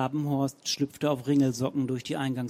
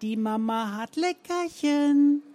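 put-on much higher, slow coaxing baby talk; Zenker impression; 10 semitones higher, 3 dB louder, slower than usual. A narrator's reading. Romanow lures the poodle in Frau Zenker's voice, high and slow. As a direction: lure the dog as its owner.

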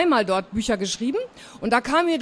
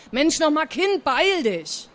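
higher, exasperated hurry-up; mildly angry; 5 semitones higher than usual. A narrator's reading. Aunt hurries niece out of the stall, mildly angry. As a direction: chivvy the child with a touch of crossness. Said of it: "klang jetzt ein bisschen wütend".

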